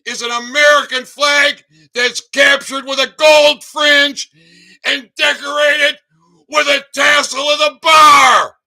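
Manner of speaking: loud, angry ranting; ranting shout. Real, not acted. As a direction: bellow it at the crowd. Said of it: "How was that for a rant".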